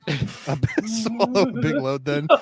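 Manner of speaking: laughing read. Retold or imitated. Laughing through line.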